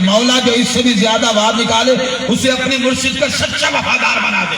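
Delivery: loud, rising prayer chant over music; building prayer call. Acted. Preacher's escalating prayer call to the gathering, rising to a shout.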